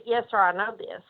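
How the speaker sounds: ordinary delivery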